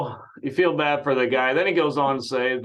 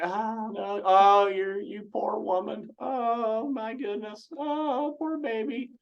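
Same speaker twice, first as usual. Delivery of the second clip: mock sympathy; 8 semitones higher, slower than usual. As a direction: coo it like soothing a baby. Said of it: "When a wife calls in to complain".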